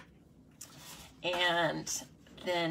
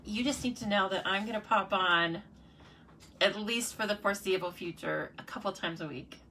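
normal talking voice

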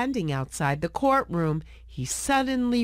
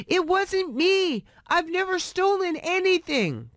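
crying out; 11 semitones higher than usual. Acted. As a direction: beg them to believe you. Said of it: "cried Oliver".

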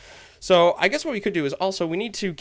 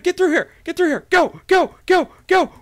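urgent; 12 semitones higher, 6 dB louder than usual. Pretend. Fleeing mobs, urging himself on.

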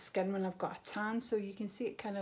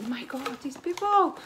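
higher and louder, amazed at the box; amazed husband; 6 semitones higher, 5 dB louder than usual. Retold or imitated. Husband gasping at the huge parcel, amazed and loud. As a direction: act out the husband's astonished outburst. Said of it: "my husband's like".

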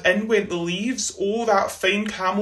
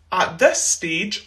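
usual speaking voice

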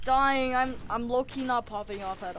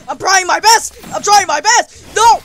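high, loud, repeated shouting; frantic protest; 8 semitones higher, 15 dB louder than usual. Real, not acted. Player losing while trying hard, frantic shouting.

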